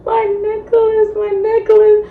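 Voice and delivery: very high, hammed-up tearful wail; imitating a drunken crying fit; mock crying. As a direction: squeal it through fake sobs. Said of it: "and I'm crying".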